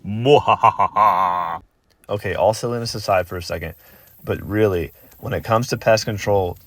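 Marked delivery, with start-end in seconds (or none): 0.0-1.6 slow, drawn-out evil cackle; mad scientist laugh. Pretend. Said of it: "I feel like a mad scientist".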